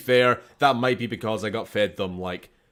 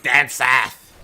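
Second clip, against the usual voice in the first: loud mock Southern English accent; 10 dB louder than usual. Teasing a friend about Southern accents, mock Southern English accent. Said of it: "How do you feel about Southern accents?".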